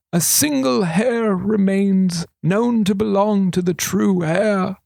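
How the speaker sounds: a hint of a sly old regent. A narrator's reading. Regent confiding to thief, lightly voiced old man.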